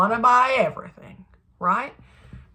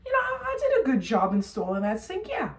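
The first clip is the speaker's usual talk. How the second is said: much higher, softer coy voice of a flattered dad; flattered installer; 9 semitones higher, 4 dB quieter than usual. Retold or imitated. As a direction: play someone won over by praise, bashfully proud. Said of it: "makes people feel like".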